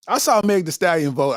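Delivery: usual speaking voice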